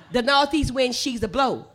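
loud Gullah declaration. Shown as a demonstration. Teacher repeating the story line for the ladies, loud Gullah. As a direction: belt it out in Gullah. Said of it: "they say she".

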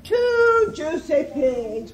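loud, drawn-out wolf relish; gloating cry. A narrator's reading. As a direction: savour it loudly and very slowly. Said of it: "Wolf cried".

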